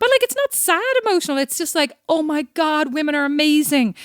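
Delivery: high, gushing and teary-eyed; gushing emotional. Retold or imitated. Sister gushing over the Nike video, high and teary. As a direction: swoon over how amazing women are. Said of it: "It's so emotional".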